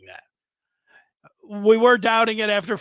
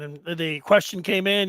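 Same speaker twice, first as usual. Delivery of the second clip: usual speaking voice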